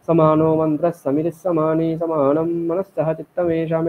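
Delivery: mantra rattled through without pauses; rushed recitation. Shown as a demonstration. Teacher showing how hurried recitation sounds unpleasant, rushed. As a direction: run the words together, no held vowels. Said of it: "এটা স্পিডেও আপনি বললে".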